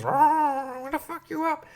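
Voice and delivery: high, hushed mock ghost threat; haunting ghost. Dead queen haunting his dreams, hammed-up ghostly menace. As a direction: play a spooky apparition, thin and soft.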